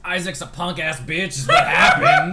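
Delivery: loud, laughing outburst; shouting. Real, not acted. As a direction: yell it out in mock outrage. Angry recap shout.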